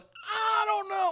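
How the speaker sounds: high, long-drawn imitation of doubters; mock doubtful whine. Co-host imitating fans unsure of the signing, mock doubtful whine. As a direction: drag it out high and hesitant, mocking.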